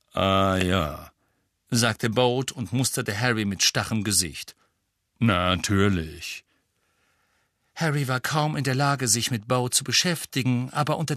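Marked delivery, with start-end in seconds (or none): 0.1-1.1 low, slow graveside voice; sepulchral voice. A narrator's reading. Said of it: "mit Grabesstimme". 5.2-6.4 low, slow graveside voice; sepulchral voice. A narrator's reading. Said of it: "mit Grabesstimme".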